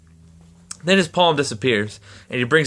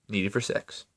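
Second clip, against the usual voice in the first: hushed echo; 4 semitones lower, 11 dB quieter than usual. A narrator's reading. Echoed more softly, lower.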